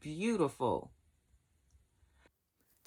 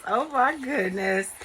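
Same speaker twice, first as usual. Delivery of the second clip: choked up; 7 semitones higher than usual. Real, not acted. Vlogger touched by a friend's gift, choked up. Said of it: "I'm getting choked up already".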